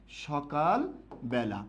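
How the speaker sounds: slow, spelled out for writing; slow dictation. Shown as a demonstration. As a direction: dictate the sentence slowly. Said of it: "আমি বাক্য লিখলাম". (ধীরে ধীরে শ্রুতলিখন)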